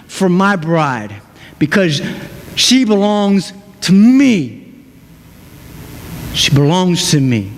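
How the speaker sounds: tender Jesus. Acted. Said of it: "Jesus steps on the scene and says".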